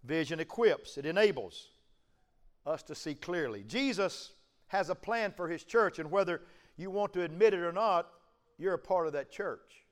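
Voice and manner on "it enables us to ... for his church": ordinary delivery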